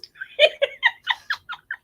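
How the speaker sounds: shrill cackle; squealing with laughter; high squealing laugh. Real, not acted. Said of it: "I can't stop laughing".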